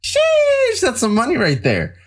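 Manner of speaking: mock amazed. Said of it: "you can go".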